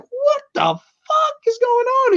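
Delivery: high, slow incredulous outcry; mock bewilderment. Viewer recalling the coffin ride scene, high drawn-out mock bewilderment. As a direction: stretch it out in squeaky, exaggerated disbelief. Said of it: "I remember being like".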